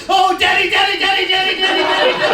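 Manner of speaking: high, hammed-up imitation of mom's cries; rapid high-pitched repeated cries, mimicking her; mom yelling. Imitating mom heard on the fast-forwarded tape, yelling. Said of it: "You just hear my mom yell".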